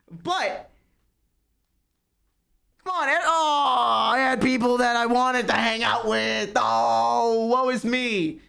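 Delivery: hammed-up crying wail, slow and drawn out; theatrical sobbing woe; mock wailing lament. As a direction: wail it like a melodramatic tragedy. Mock lament about being lonely.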